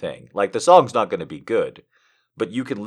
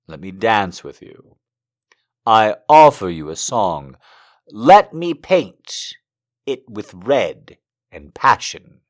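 poem reading; 7 dB louder, slower than usual. Acted. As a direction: let each image land gently. Streamer reads a poem aloud.

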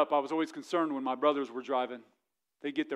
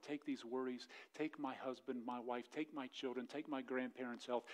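quiet prayer; 5 dB quieter than usual. Acted. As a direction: lay each worry down softly. Voices a surrender prayer.

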